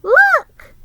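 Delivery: put-on very high, loud little boy's shout; delighted small boy's cry; excited child. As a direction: show everyone the box. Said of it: "said Brad".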